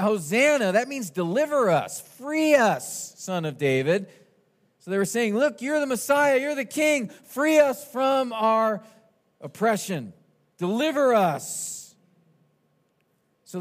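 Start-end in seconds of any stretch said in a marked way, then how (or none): 0.0-0.7 louder, higher crowd cry. Retold or imitated. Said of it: "they were crying out". 1.1-4.1 raised, high-pitched plea; crying out. Retold or imitated. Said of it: "they were crying out". 5.3-11.9 high, drawn-out plea for rescue; crying out. Retold or imitated. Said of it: "they were crying out".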